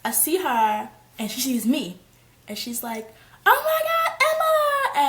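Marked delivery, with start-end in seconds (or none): 3.4-5.1 high, loud squeal of recognition; excited greeting. Retold or imitated. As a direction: imitate a friend shrieking hello. Excited greeting of a friend acted out.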